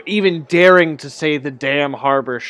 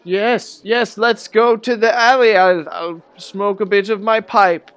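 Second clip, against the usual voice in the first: put-on high, overly agreeable old man voice; nervous old man jumping at the chance; eager old man; 7 semitones higher, 4 dB louder than usual. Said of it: "He's like".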